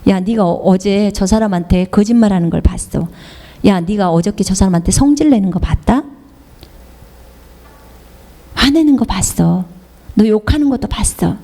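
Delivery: lightly voiced devil, low and insinuating; whispering devil. The devil accusing a believer of sins, low and insinuating. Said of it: "마귀가 여러분에게 속삭일 거예요".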